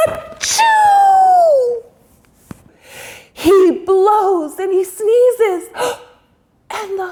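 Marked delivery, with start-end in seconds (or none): 0.1-1.8 loud high bear sneeze; sneezing bear; big sneeze. A narrator's reading. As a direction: blast out a huge achoo.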